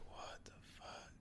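a hushed, spooked whisper in the bathroom; scared whisper. Retold or imitated. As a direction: breathe it out in a frightened whisper. Scared, hearing a ghost voice.

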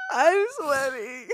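slow, laughing, near tears; hysterical laugh. Real, not acted. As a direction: squeeze it out through laughter. Still laughing hard.

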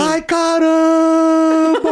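high, drawn-out yell; long falling-ride scream. Retold or imitated. Rider showing his scream as the drop falls, drawn-out yell. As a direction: scream it slowly, stretching the last vowels up high. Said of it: "eu fui gritando".

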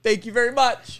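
high, pleading mock echo; playing helpless. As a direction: parrot the caller's sugary sign-off. Echoing the voicemail caller.

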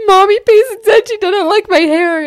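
imitated child wail, very high and loud; hammed-up upset kid telling on a friend; Navy, so sad. Daughter tells mom about the hair insult, high wailing imitation. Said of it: "she was, like, so sad".

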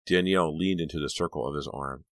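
ordinary delivery, nothing marked

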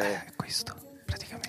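soft breathy whisper into the mic; ASMR whisper. Shown as a demonstration. Demonstrating ASMR when asked.

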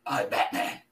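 breathy whispered Batman growl. Pretend.

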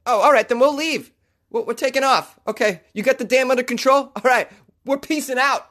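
high, chirpy beaver talk; cheerful beaver voice. Pretend. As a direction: play a polite beaver backing off. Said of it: "the real beavers come by and go".

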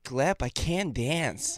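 very slow, drawn-out try at an American accent; attempted American accent. Shown as a demonstration. Russian dancer repeats a test line on request, attempted American accent. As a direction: put on your most American accent, slowly. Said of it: "the most American accent you can do".

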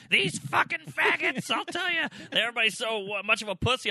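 high, loud mock old-man rant; cranky old man. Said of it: "some old guy being like".

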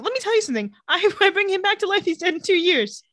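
high-pitched, incredulous refusal; comically fed up, pitched well up; mock exasperation. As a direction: play a hammed-up witch refusing a favor. [name] mockingly refusing to revive boy.

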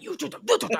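high excited call; high, excited newsroom cheer; mock newsroom shout. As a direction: toss it like an office cheer. Newsroom people calling out.

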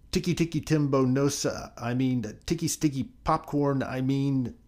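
high-pitched, stumbling over the name; rushed, tongue-tied. A narrator's reading. Poor Chang trying the long name for his mother, rushed and tongue-tied. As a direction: gabble it and trip over the words. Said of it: "tried to say the long name as fast as he could".

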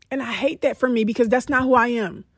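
normal talking voice

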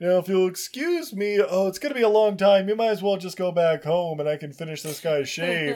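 playing the barber. Barber voiced in retold movie.

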